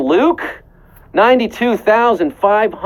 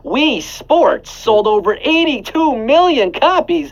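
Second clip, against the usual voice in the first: excited reveal; 7 semitones higher than usual. Acted. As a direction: pitch leaps up on copies, slow build. Host revealing big number.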